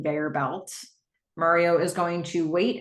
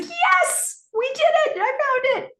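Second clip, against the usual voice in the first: very high, jubilant cry; excited cheer; 4 dB louder than usual. Acted. Cam cheering to Gordon over finding the Warp Zone, excited. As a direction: burst out in high-pitched triumph. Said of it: "They're so excited".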